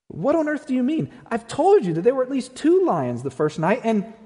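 high, exasperated protest; indignant Shasta. A narrator's reading. Shasta objecting to the lion, high and indignant.

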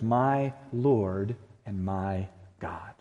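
"My" and "my" drawn out, each word set apart; slowly weight each "my" in "My Lord and my God"; stress on MY. Retold or imitated. Thomas confessing to Jesus, slow weight on each "my". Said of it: "Do you hear the my's in there?".

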